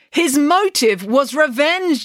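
high, slow headmaster voice; headmaster declaring. A narrator's reading. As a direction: pronounce it like a stern verdict. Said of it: "declared the headmaster".

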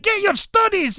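high, loud Scottish scolding; Scottish teacher. Retold or imitated. Driver mimicking teacher with stick and book, high Scottish scold. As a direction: order the class in a mock Scottish screech. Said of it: "she was like".

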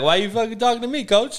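high, rapid-fire indignant comeback; Alan. Retold or imitated. Alan asks coach why he's talking to him, mock indignant. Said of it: "Alan be like".

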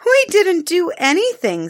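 put-on a bright child's eager question; a hint of a young girl's voice; little girl Taffy.

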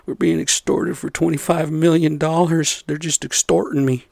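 high mocking whiny voice; mocking whine. Mocking the politician crying extortion on Fox, in a high whine. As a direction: parody the politician's complaint, whining high. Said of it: "Fox saying".

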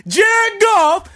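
full-volume hype-man holler; hype shout. Acted. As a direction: yell the name high and very loud. Shouts player's name as hype.

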